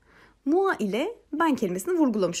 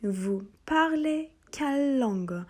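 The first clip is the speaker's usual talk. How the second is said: slow, higher French question for the class; slow question model; 4 semitones higher, slower than usual. Shown as a demonstration. Teacher to students, slow model question. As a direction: model the question slowly and clearly. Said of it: "Şimdi devam edelim".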